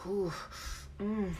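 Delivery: breathy sighs and moans between the words; heavy breathing demo. Shown as a demonstration. Showing the audience the heavy breathing, ragged and sighing. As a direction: let the breath take over, sigh it out. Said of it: "y'all know that breathing".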